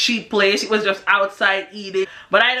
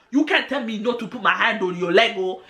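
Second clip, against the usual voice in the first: put-on African accent. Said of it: "like, this African accent".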